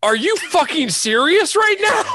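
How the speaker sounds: high, loud mock disbelief; incredulous lady. Imitating the lady at the hearing, high loud disbelief. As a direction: play the shocked woman beside him, high and loud. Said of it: "She was like".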